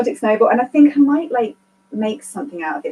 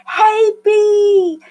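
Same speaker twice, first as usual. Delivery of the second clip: bright greeting; 10 semitones higher, 5 dB louder than usual. Real, not acted. Greeting a viewer in chat.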